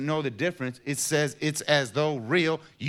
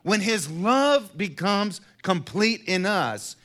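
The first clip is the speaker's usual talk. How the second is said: rising, louder preacher's build; preaching at full force; 5 semitones higher, 5 dB louder than usual. Acted. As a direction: preach it out, climbing higher. Preaching rises again.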